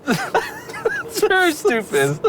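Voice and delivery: words squeezed out through laughter; laughing. Real, not acted. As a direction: say it while cracking up. Laughing while speaking.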